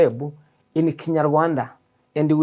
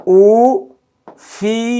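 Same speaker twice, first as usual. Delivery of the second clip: ufite said higher and louder, rising; emphatic repeat; 5 semitones higher, 5 dB louder than usual. Shown as a demonstration. Teacher repeats ufite for learners, louder and higher. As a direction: ring out ufite for the class. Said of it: "Three syllables".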